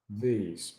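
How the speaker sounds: "these" with the tongue tip tapping the upper teeth; "these" softly, tongue tapping behind the upper teeth; tapped voiced th. Shown as a demonstration. Teacher showing how to tap the teeth on "these". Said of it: "like this".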